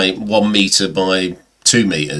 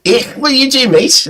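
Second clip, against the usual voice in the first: high-pitched, rough attempt at Scouse; Liverpudlian accent. Drunk local challenging the photographer, in high mock Scouse. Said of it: "I can't do the Liverpudlian accent".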